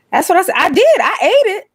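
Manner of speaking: high, loud mock protest; squealed, emphatic comeback; excited insistence. High excited reply to a chat comment.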